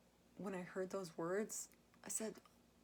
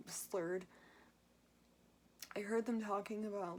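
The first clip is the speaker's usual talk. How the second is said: ordinary delivery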